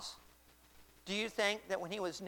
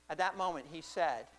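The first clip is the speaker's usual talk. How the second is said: normal talking voice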